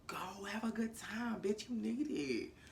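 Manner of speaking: a slow, hushed nudge from the inner voice; whispered urging. Retold or imitated. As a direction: coax her in a tempting whisper. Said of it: "the reflection was like".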